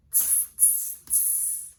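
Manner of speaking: whispered monkey tsking; monkey tss. A narrator's reading. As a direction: tsk back like mocking monkeys. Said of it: "the monkeys only stamped their feet back at him and said".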